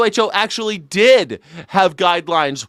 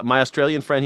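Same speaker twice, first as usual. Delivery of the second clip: ordinary delivery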